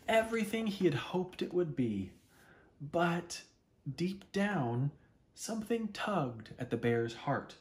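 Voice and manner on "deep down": ordinary delivery, nothing marked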